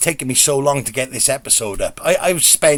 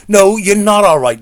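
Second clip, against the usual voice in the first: firm insistence; 4 semitones higher, 6 dB louder than usual. Retold or imitated. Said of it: "the elderly lady is like".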